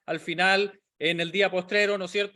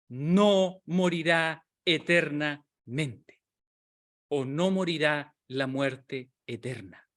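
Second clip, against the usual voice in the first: muy lenta y solemne, palabra por palabra; proclámalo despacio, con peso; palabras de Jesús solemnes; slower than usual. Retold or imitated. (Jesús promete a Marta la vida, muy lento y solemne)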